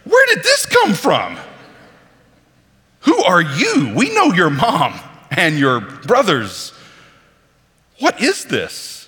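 put-on shocked neighbours who knew him as a boy; incredulous townsfolk. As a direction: voice the hometown crowd in disbelief. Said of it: "people who've known him for thirty years say".

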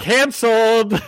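high, loud mock verdict; playful high-pitched shout; mock proclamation. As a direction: call it out like a joking public denunciation. Calling out a joke verdict.

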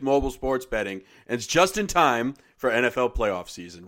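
upbeat sponsor read, punchy. Acted. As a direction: sell it like a radio spot.

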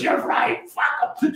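laughing quote. Retold or imitated. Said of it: "He goes".

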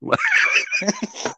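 squealing burst of laughter; high shrieking laugh. Real, not acted. Laughs at the question.

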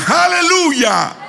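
very high, drawn-out hallelujah cry; soaring preacher's hallelujah; rallying praise shout. Real, not acted. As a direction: cry it out slowly to rouse the congregation. Repeats call to congregation, bigger.

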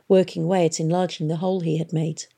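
ordinary delivery, nothing marked

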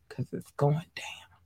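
slow whispered tease; dramatic whisper. Real, not acted. Host teasing the show changes in a slow whisper. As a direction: whisper it with suspense.

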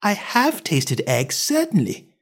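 put-on high, slow girlish admission; careful high-pitched girl; Alice, truthful. A narrator's reading. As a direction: admit it slowly in a little-girl voice. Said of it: "said Alice".

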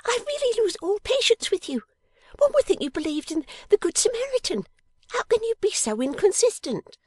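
put-on very high, loud, exasperated girlish voice; exasperated little dressmaker; irritable scolding. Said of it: "cried Miss Wren irritably".

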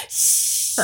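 breathy shushing; unvoiced shh; whispered shush. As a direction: hush them, voiceless. Drunk shushing friends.

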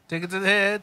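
loud hype chant; cheering. Real, not acted. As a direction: egg him on, loud and high.

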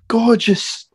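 put-on high, admiring Australian accent; Australian wildlife host in awe; Australian Crocodile Hunter. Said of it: "his Australian accent was way better".

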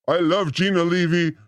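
ordinary talk, nothing marked

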